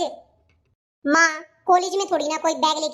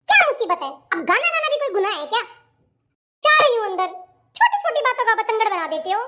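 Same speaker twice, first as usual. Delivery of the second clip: higher-pitched aggrieved complaint; exasperated whine; 4 semitones higher than usual. Acted. Daughter fed up with being scolded over a song, exasperated. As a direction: whine it out as you storm off.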